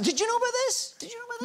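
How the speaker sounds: high-pitched astonished questioning; incredulous. Real, not acted. Amazed, quizzing the others present.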